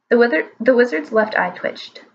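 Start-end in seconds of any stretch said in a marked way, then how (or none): none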